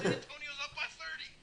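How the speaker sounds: high-pitched, thrilled announcement; excited fan. Retold or imitated. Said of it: "so exciting".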